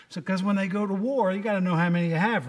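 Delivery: ordinary talk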